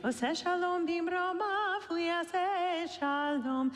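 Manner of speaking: ordinary talk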